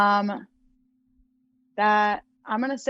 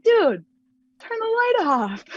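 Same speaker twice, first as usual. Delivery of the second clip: 1 exasperated plea; 13 semitones higher, 4 dB louder, slower than usual. Retold or imitated. Roommate leaves the light on.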